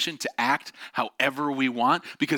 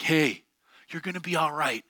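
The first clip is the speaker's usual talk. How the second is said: lightly mocking, higher-pitched pep talk; parroted comfort, pitched up; trite encouragement; 5 semitones higher than usual. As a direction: imitate a well-meaning, empty reassurance. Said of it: "hearing these trite, like, encouragements".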